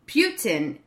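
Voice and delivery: "Putin" said PYOO-tin, with a y-glide and clear t; "Putin" opening like "pew"; wrong pronunciation. Shown as a demonstration. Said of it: "Putin isn't like".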